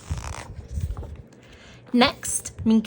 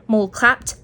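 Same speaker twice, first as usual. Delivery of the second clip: ordinary delivery, nothing marked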